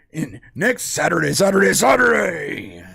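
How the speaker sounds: shouted announcer hype; monster truck voice. Acted. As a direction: yell it like a monster truck promo. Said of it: "the monster truck voice".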